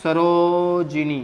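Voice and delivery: सरोजिनी very slowly, with a long stretched ro; drawn-out correct form. Shown as a demonstration. Teacher answering "what is it?", सरोजिनी slowly drawn out by syllables. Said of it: "क्या होता है?".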